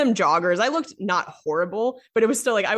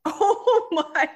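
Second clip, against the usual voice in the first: higher, slow drawn disbelief; shocked; 13 semitones higher, slower than usual. Real, not acted. Friend hearing the gross part, drawn shock. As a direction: stretch out the shock.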